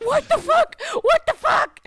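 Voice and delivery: loud, very high squeal; high-pitched disbelief bordering on laughter; mock bewilderment. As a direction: squeak it out in exaggerated alarm. Acting out bewildered reaction, laughing.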